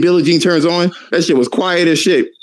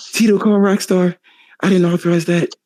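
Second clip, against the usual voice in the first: higher, fussy pop star impression; 3 semitones higher than usual. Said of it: "[name] was like".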